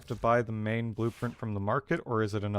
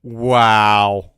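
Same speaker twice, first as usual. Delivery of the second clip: a loud, drawn-out wow; big loud wow; 15 dB louder than usual. Real, not acted. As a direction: belt out the amazement. Reacting to game's sassy line.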